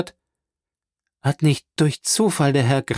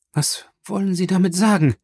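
higher, shuddering question; shuddering cry; 6 semitones higher than usual. A narrator's reading. Baroness asks the prosecutor in dread, shuddering. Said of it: "rief Frau Danglars schaudernd".

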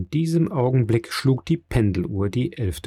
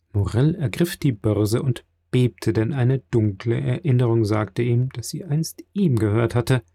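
ordinary delivery, nothing marked

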